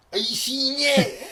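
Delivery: high, slow drawn-out exclamation; mock excited schoolboy. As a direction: play a kid bursting with amazing news. Imagined boy yelling after monster sighting.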